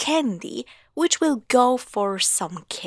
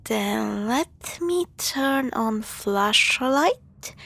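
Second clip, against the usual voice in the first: normal talking voice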